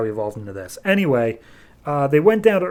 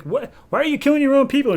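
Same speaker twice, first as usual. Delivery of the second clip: shocked; 13 semitones higher, 3 dB louder, faster than usual. Retold or imitated. Hellspawn shocked at Superman.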